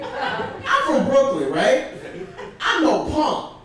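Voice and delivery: usual speaking voice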